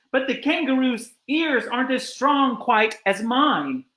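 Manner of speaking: lightly voiced elephant calling back; Horton calling back. A narrator's reading. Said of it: "And Horton called back".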